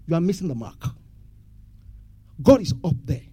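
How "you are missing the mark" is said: normal talking voice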